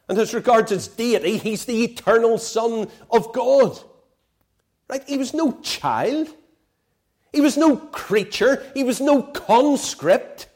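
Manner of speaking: loud, rising sermon voice, hammering each point; full-force preaching. Acted. Preacher driving home to his friends, loud full-force preaching.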